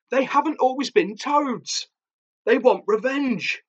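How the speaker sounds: high, desperate confession; high-pitched, urgent, dramatic warning; frantic revelation. A narrator's reading. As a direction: cry it out in dread. Auntie confesses amid toad attack.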